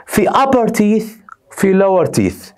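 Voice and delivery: slow model reading. Shown as a demonstration. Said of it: "الاسنان قسمين".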